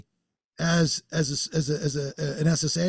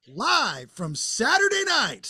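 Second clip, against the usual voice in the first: slow, higher-pitched show announcer call; show-opening announcer; 6 semitones higher, slower than usual. Acted. Man joking about a televised debate, mock announcer call. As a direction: draw it out like a TV show opener. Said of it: "the only thing they needed to add at the beginning was".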